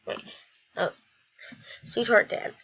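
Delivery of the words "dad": ordinary talk, nothing marked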